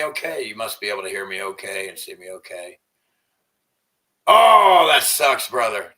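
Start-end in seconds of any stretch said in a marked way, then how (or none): 4.3-5.9 sudden loud oh, then easing down; loud shocked sympathy for a viewer; surprised oh. Real, not acted. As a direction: blurt out the oh in surprise. Reacting to a viewer's bad news.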